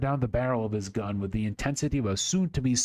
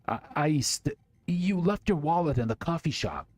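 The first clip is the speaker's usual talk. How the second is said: higher, stumbling scared blurt; boy at gunpoint blurts out about the wallet, panicked stammer; 7 semitones higher than usual. Acted.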